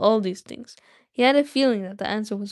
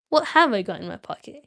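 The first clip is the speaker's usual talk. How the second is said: usual speaking voice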